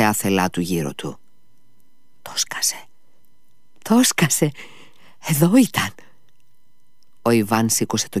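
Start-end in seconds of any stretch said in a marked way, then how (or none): 2.2-2.8 breathy whispered secret; hushed whisper. A narrator's reading. Ivan raving, hushed. 3.8-5.9 much higher, sharp and urgent; feverish high cry. A narrator's reading. Ivan insisting to Alyosha the visitor was here, high feverish cry. Ivan raving, loud take.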